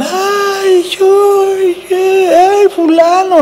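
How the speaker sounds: high mock fan shriek; hammed-up screaming admirer. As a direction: squeal a name like a swooning fan. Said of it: "y gritaban".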